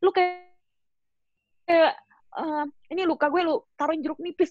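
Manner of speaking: high, loud exasperated retort; high mock outrage, as if someone squeezed lime on a wound; inner outburst. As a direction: snap at him in your head. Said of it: "dalam hati gue".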